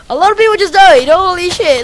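shocked shout. Real, not acted. Shock at mass deaths.

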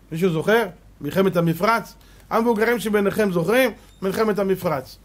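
ordinary talk

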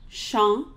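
champ with a dark nasal ah, no p; French nasal champ. Shown as a demonstration. Singing teacher modeling champ, dark nasal ah. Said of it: "So say it with me".

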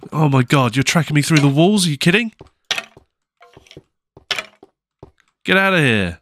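outraged at the mob; quick, panicked disbelief; exasperated panic. Real, not acted. Mob tracking through walls.

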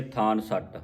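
ਥਾਨ ਸੱਟ, n stuck to the first word; wrongly joined words. Shown as a demonstration. Teacher quoting congregations' error, misjoined ਥਾਨ ਸੱਟ. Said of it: "ਇਸ ਦਾ ਉਚਾਰਨ ਨਹੀਂ ਹੈ".

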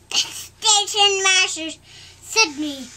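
high, loud put-on voice; engine voice. Thomas tells the family who sent him, loud. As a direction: announce it proudly. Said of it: "said out Thomas's team".

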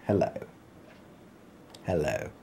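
a low quiet greeting; soft low hello. Shown as a demonstration. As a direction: drop it down to a murmur. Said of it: "I've already said that".

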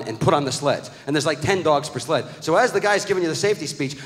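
ordinary delivery

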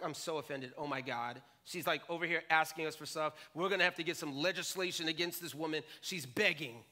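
low, scoffing voice for the offended disciples; mock-indignant disciples sneering. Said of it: "the disciples are like".

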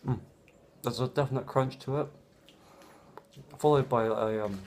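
speaking with food in mouth; mid-bite muffled speech; chewing while talking. Real, not acted. Seen 0: Bit toast at -4; lips pressed, jaw working early in the words.

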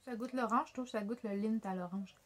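talking with mouth full; chewing chocolate. Real, not acted. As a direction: between bites of chocolate. Seen 0: holds bitten chocolate at lips, mouth working while speaking.

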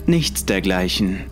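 ordinary delivery

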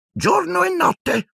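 very high, slow croak; a grudging old elf croaking it out slowly. A narrator's reading. Creature croaking his report to Harry, very high and slow. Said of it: "gracchiò Creature".

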